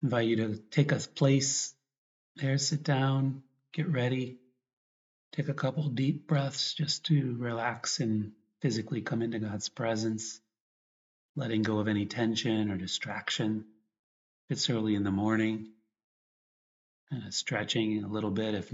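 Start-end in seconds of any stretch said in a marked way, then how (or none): none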